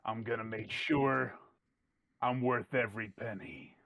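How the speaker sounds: a hint of a menacing thug; menacing brute. Hired brute promising to earn his pay, low menace.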